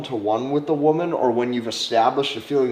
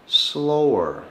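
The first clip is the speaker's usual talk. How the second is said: the word slower drawn out; 3 semitones higher than usual. Shown as a demonstration. Teaching confidence through slow talk, drawn out. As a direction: stretch it long. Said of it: "is to talk".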